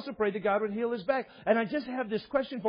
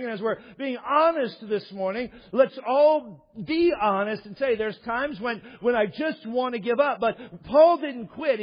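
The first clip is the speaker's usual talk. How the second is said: louder, driving pulpit voice; fervent preaching; 4 semitones higher, 4 dB louder than usual. Acted. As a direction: urge the congregation on, full force. Preaching at full force.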